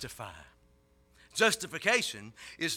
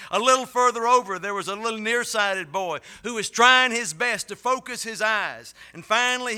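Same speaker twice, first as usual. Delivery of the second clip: passionate preaching; 5 semitones higher, 5 dB louder than usual. Acted. Rising sermon story.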